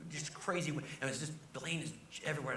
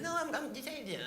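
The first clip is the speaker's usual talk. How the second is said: Jay Leno voice; 13 semitones higher than usual. Retold or imitated. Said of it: "he said".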